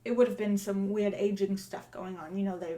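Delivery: put-on chatty Cockney accent; Cockney accent, in-character interview; Cockney lamplighter. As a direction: talk as a musing Cockney lamplighter.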